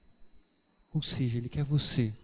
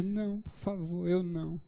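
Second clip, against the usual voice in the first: hushed pleading; 3 semitones higher, 8 dB quieter than usual. Acted. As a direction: plead quietly, afraid to be chosen. Believer begs God, shrinking back. (súplica baixinha e lenta, com medo)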